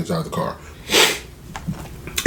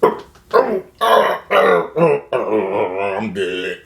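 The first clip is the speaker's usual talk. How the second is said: mock movie death; much slower than usual. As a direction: groan like an actor dramatically dying. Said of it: "You know how in the movie they get shot".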